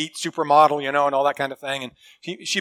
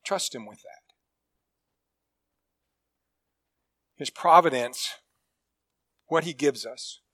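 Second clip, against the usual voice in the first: soft, low and tender; 4 semitones lower, 8 dB quieter than usual. Real, not acted. Preacher gently reassuring his congregation about God's care, soft low tender voice. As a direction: bring it down gently to reassure them. Preacher softens after story.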